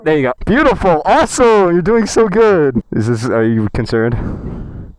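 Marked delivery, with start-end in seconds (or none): 0.0-2.8 much higher, louder cheering; excited praise. Real, not acted. Boyfriend cheering girlfriend's first bike ride, excited. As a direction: hype her up as she rolls.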